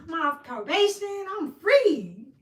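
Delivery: high, loud, giddy greeting; mock cheerful sister. Sister cheerfully greeting the police officer, high and giddy. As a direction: sing it out like you're thrilled to be stopped. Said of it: "She was like".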